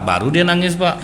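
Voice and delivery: ordinary delivery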